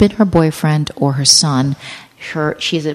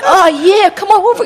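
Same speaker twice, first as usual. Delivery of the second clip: partying bros; 14 semitones higher, 5 dB louder than usual. Retold or imitated. Mocking the party guys calling the ladies over, high and loud. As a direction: ham up a sleazy party invite, high and loud.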